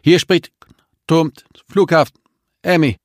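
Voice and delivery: high, loud voice through a crackly speaker; a tinny radio transmission from the tower; over a radio. A narrator's reading. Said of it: "kaum hörbare weibliche Stimme aus dem Lautsprecher".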